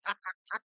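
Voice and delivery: short burst of laughter; amused, breaking into laughter. Real, not acted. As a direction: laugh at your own story. Amused by the story she retells.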